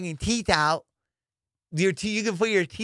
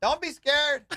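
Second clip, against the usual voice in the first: mocking heckle; 9 semitones higher, slower than usual. Mocking quote of heckling bystander.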